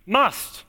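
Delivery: "Must" alone, punched and falling; stress on MUST. Shown as a demonstration. Preacher drives the key word home, stressing "must". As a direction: set "must" apart as one firm word. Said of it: "I'll put the emphasis on the right word here".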